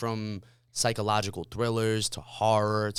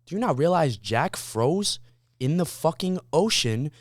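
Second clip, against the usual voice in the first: indignant; 5 semitones higher, 4 dB louder, slower than usual. Retold or imitated. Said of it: "I'm like".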